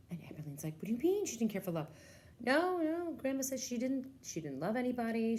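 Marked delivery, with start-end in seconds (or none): 0.7-1.9 put-on fast, higher protesting kid; child interrupting the story; puzzled child. Child questioning grandma. 2.4-5.4 put-on much higher, louder kid voice; child correcting grandma; insistent child.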